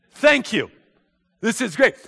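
high, loud, delighted thanks; thrilled. Acted. Gabriel thanks God for the job, thrilled. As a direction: burst out with gratitude.

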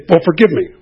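careless demand. Retold or imitated. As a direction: brush off the wrong as a trifle. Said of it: "how dare you come to me and say".

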